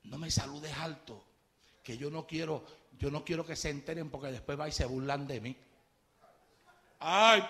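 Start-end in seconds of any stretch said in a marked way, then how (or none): 0.0-5.6 low hushed voice, much softer, begging her not to give him away; hushed pleading. Retold or imitated. Coworker secretly begs the church sister, hushed and much softer. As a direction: keep it low so nobody at work hears.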